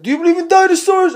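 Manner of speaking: high, loud, funny comedian voice; exaggerated high-pitched celebrity impression; Tracy Morgan. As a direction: squawk it out as a comic impersonation. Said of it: "he talks really funny".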